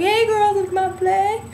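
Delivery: high, bright showing-off call; a giddy mock shout-out; playful call. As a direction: sing it out to the girls.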